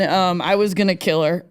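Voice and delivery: usual speaking voice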